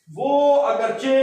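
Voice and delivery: ordinary delivery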